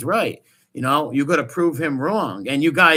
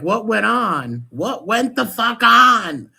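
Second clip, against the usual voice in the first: slow, loud, pitched-up incredulous demand; exasperated demand; 7 semitones higher, 7 dB louder, slower than usual. Real, not acted. Host demanding to know what happened, loud and incredulous. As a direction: drag it out in loud disbelief.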